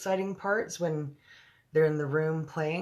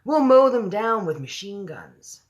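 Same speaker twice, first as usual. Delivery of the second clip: loud, slow, higher military cry; 6 semitones higher, 7 dB louder, slower than usual. Acted. The army head urges gunning down the giants, loud and slow. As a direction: call it out like a general. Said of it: "cried the head of the army".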